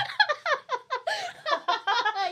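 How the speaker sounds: high, loud burst of laughter; a big shrieking laugh; loud delighted laugh. Real, not acted. Laughing at shared answer.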